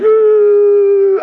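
high, loud mock wail of despair; pitiful cry. Preacher reliving his bedroom breakdown, high pitiful wail. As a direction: let out a pitiful, faithless moan. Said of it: "It was a pitiful cry".

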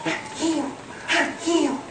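high, hushed imitation of an amazed woman; freaking out. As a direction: squeak it softly, as if overwhelmed. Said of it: "She's freaking out".

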